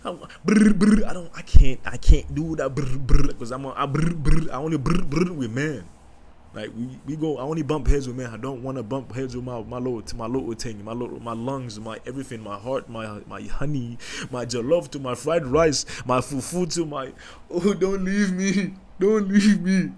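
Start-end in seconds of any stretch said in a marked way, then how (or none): 0.0-5.8 high, loud stammered brr noises; mock sputtering man. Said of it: "I don't want you to be like". 17.5-19.9 high, slow whiny plea; mock pleading.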